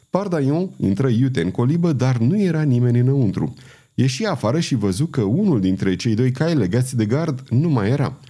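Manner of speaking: plain reading voice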